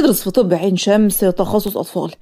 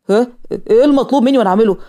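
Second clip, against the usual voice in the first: ordinary delivery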